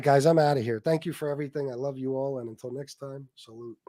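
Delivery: normal talking voice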